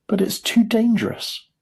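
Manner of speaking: high, emphatic warning; cried warning. A narrator's reading. Mister Wonka warning the boy, cried warning. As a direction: pitch leaps up in alarm. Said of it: "cried Mister Wonka".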